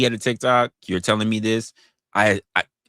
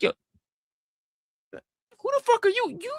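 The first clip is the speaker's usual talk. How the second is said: incredulous. Retold or imitated. Retelling his reply to chatter.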